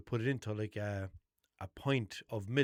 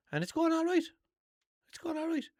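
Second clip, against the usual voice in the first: mock upbeat; 4 dB louder than usual. Mock upbeat diet report.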